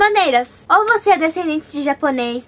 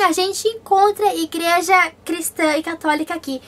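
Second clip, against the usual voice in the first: normal talking voice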